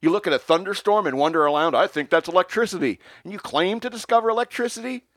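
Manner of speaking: high, loud mock tirade; railing rant. Ranting at Ben Franklin in front of the French guests. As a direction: rant at him, building. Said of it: "continued railing after him".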